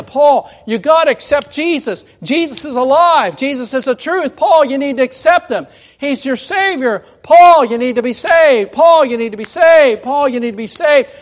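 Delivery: loud, high, insistent pleading; the Holy Spirit pleading with Paul, loud and urgent; urgent pleading call. Acted. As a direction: call out to Paul again and again, pressing him.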